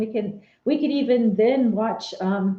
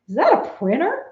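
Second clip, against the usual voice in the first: puzzled agent; 7 semitones higher, 4 dB louder than usual. Retold or imitated. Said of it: "he goes".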